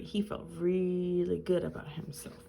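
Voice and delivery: usual speaking voice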